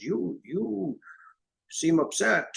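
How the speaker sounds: a hint of an old man's voice, noticing; old Hungarian man. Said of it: "Hungarian guy next to him says".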